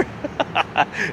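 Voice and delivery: soft real chuckle; chuckling. Real, not acted. Chuckles addressing Jeff.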